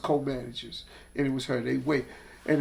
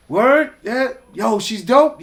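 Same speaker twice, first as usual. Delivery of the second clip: surprised interest; 6 semitones higher, 7 dB louder, slower than usual. Retold or imitated. Irv suddenly interested, retold.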